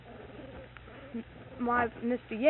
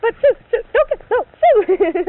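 flustered boss; 12 semitones higher, 18 dB louder than usual. Retold or imitated. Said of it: "And he was down there".

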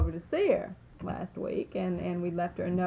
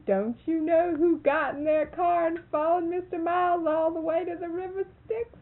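high, loud, teasing question through laughter; laughing teasing; 10 semitones higher, 4 dB louder than usual. Retold or imitated. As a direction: tease him, half laughing. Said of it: "she said".

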